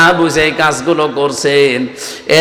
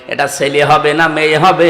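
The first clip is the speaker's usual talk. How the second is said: ordinary delivery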